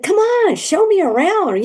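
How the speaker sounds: high, bouncy come-on; eager plea. Retold or imitated. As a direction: beg to be shown around, high and excited.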